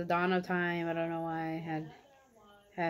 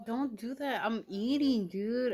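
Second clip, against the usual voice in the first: higher and louder, mock irritated scolding; annoyed scolding; 6 semitones higher, 3 dB louder, slower than usual. To the boy kissing her while she eats, higher annoyed scolding. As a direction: retell the telling-off a bit heated. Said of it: "was like".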